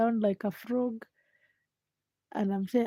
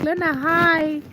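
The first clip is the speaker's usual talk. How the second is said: higher, louder, slow chirpy hi; mock viewer greeting; 9 semitones higher, 8 dB louder, slower than usual. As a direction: act out a shy viewer saying hi. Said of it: "Just be like".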